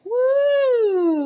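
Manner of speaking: high whooping yell; a gleeful woo from the ads; mock cheer. Ads cheering across the internet, mock whoop.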